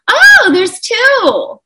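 high, loud, drawn-out surprised exclamation; retold surprise. Mother imitating the sonographer spotting twins, high loud surprise. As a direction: mimic a startled discovery, stretching it out.

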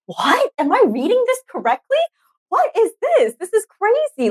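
high, incredulous gasp of shock; can't believe what she's reading; disbelief. Retold or imitated. S1 retelling reading survey answers, stunned high disbelief.

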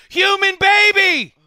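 very high, loud, drawn-out shout; sudden warning yell. Pretend. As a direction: holler it as a mock alarm. Throws doll at guest, testing hands.

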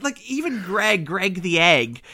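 ordinary talk, nothing marked

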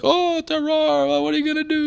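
high, far-off put-on voice; squeaky shrugging winemaker impression; mock resigned vintner.

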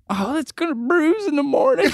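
high, giggly old lady impression; laughing old lady voice. Old lady telling him it will bruise, high and laughing. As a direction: mimic her through laughter. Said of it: "And she's like".

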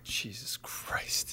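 breathy exasperated whisper. Acted. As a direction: whisper it under your breath. Producer despairs under breath.

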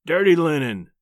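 loud, brief rumble from the husband; booming, gruff interruption; rumbled warning. A narrator's reading. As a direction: rumble it out over her scolding. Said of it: "he rumbled".

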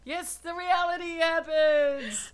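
high, loud words through laughter; laughing. Real, not acted. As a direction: laugh while talking. Laughing at recording mishap.

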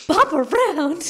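high, slow disbelieving question; mock disbelief, drawn out high; incredulous echo. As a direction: repeat it back in shock. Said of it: "And you were like".

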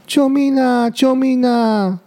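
high frightened calls, the last one slow; cry for help. Acted. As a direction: call out for help while tumbling. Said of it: "小鹿突然大叫". (惊慌地高声呼救)

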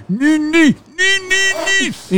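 very high, loud squealing syllables; mocking imitation. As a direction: squeal them back mockingly. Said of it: "and screaming back at me like that".

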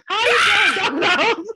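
greeting the Domino's delivery man, high and flirty with a laugh. Retold or imitated. Calling out to the pizza guy at the door, high flirty shout with a laugh.

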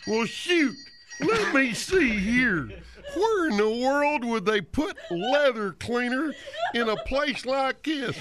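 slow, hammed-up cowboy drawl; exaggerated, drawn-out cowpoke; bad cowboy. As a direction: stretch every word like a corny movie cowboy. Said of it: "I'll do a bad cowboy".

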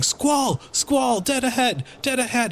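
high, ringing shout from the crow's nest; lookout shouting. Acted. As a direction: yell the warning down to the deck. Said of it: "the lookout in the crow's nest starts shouting".

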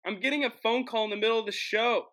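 high-pitched incredulous complaint; real dismay. Real, not acted. Host getting phone call during show, high dismay.

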